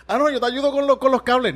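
high, loud keen offer; eager offer. Retold or imitated. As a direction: push eagerly to help with the cables. Eager offer to help with cables.

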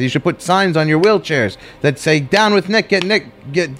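high-pitched rabble-rousing rant; retold nonsense rallying cry; angry speech. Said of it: "I was just so angry".

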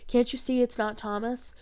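ordinary delivery

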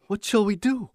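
high, urgent, pleading question; desperate plea. A narrator's reading. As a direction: beg for an answer. Frantic boy asks father.

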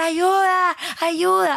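high, drawn-out cry for help; retold frantic scream. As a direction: yell for rescue as if trapped, reenacted. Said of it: "Comencé como loca a gritar".

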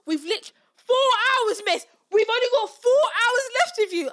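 high, loud, aggrieved complaint; mock student protest. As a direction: protest like a pupil counting what is left.

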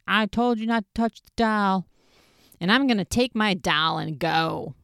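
put-on high, pouty whine of a sulking child; whiny baby tantrum. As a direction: whine it like an offended little kid. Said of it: "such a baby about it".

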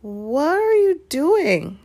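high, drawn-out question; slow, high-pitched and suspicious; startled challenge. Acted. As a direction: call it out slowly in shocked suspicion. Challenge from the corner of room.

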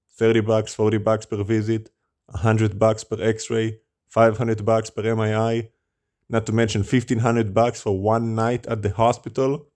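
usual speaking voice

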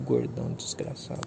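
hissed whisper of a curse. Real, not acted. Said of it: "eu vou ficar falando baixinho aqui".

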